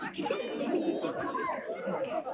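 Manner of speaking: shrill puppet laugh; high cackle. Acted.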